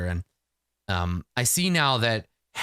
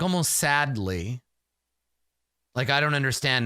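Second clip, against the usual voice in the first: ordinary talk, nothing marked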